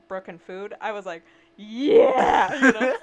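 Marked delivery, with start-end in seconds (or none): 1.6-2.9 loud, higher, thrilled yell; excited yell. Retold or imitated. Little girl to her brother, a loud excited yell. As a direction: shout it like a kid who just got her treat.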